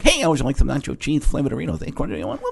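chin jutted out, talk-show host; Jay Leno impression. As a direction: chin out, talking through teeth. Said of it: "impression that I probably did was Jay Leno".

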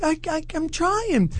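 high, soft stammered protest; small high voice, tripping over the start; helpless stammer. Retold or imitated. Retold friend's helpless stammered reply.

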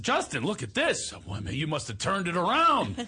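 high, gushing dad; excited proud father. Retold or imitated. Father showing his son the honor roll invite, excited. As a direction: marvel at it with mounting parental pride.

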